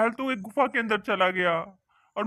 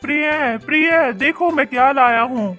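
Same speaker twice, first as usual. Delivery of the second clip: excited calling out. Acted. Fisherman to wife about his find, excited calling. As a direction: burst in bragging of a find.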